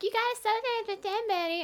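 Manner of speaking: very high mocking kid voice; nagging classmates. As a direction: mimic kids egging someone on. Voicing classmates pressuring her to dance.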